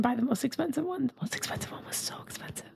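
hushed, fast whisper. Real, not acted. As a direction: whisper it like a guilty secret. Hushed confession about spending.

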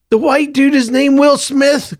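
can barely contain his excitement; loud excited whisper. A narrator's reading. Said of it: "Clovis whispered loudly again".

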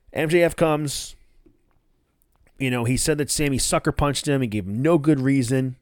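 usual speaking voice

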